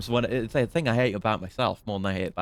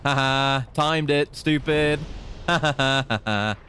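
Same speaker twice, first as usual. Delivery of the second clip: triumphant taunt with laughing; gloating laughter; 3 semitones higher than usual. Real, not acted. Gamer taunting a beaten enemy, gloating laugh. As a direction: crow over the win, a little higher. Gloating over a well-timed kill.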